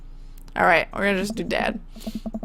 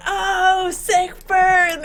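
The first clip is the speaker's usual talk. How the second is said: high, lightly voiced cheering friends; mock friends; 10 semitones higher, much slower than usual. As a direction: tease like impressed onlookers.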